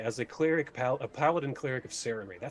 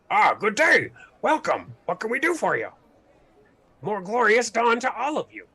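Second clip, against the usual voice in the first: high, loud, overly friendly orc greeting; cheerful orc; 9 semitones higher, 9 dB louder than usual. Acted. Orc greeting strangers in overly sweet voice. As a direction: beam a too-sweet welcome.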